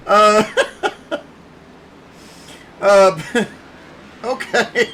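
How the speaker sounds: helpless laughter breaking up the announcement; laughing so hard. Real, not acted. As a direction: try to announce through a laughing fit. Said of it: "I'm laughing so hard".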